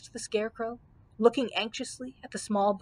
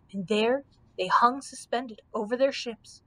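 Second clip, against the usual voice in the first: ordinary delivery, nothing marked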